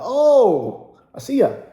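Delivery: high, loud oh of sudden understanding; the penny drops, loudly; sudden realization. Retold or imitated. Peruvian coworker finally getting the word, high and loud. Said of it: "He's like".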